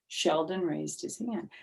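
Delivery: ordinary delivery, nothing marked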